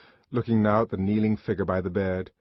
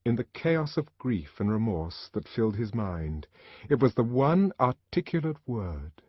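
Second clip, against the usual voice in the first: plain reading voice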